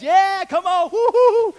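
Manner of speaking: high, loud laughing woo-hoo; real joyful laughter; laughing cheer. Real, not acted. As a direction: whoop and laugh together. Ball game demo, laughing.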